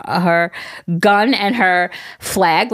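ordinary talk

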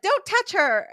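high, loud mock command; retold outburst. Host retelling the heroine's warning, mock outburst.

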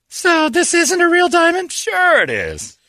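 high, slow suspicious wife. Acted. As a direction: play the doubting wife. Acted wife and husband quarrel.

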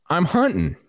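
high-pitched holler into the distance; retold calling back. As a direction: call your answer back across the farm. Hunter calls back across distance.

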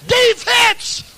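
high-pitched cheering-section chant; shouted, high sideline cheer; crowd holler. Acted. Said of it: "Everybody hollered".

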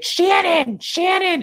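very high-pitched fan squeal; shrieky mock crowd calling for a celebrity; imitating calling fans. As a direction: call a name like a frantic fan.

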